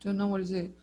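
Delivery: ordinary talk, nothing marked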